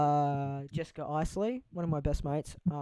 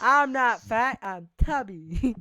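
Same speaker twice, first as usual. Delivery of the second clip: louder, slow mock of Heath's line; imitating Heath; 6 semitones higher, 8 dB louder, much slower than usual. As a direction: ham up Heath's self-defence, drawn out. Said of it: "That's what Heath says".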